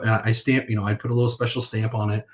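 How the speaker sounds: ordinary delivery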